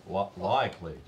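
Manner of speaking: mock Australian "likely"; spelled-out Australian likely. Shown as a demonstration. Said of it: "and then L K L Y".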